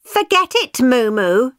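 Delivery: high, cheeky little voice; cheeky pancake. Acted. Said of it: "the pancake said".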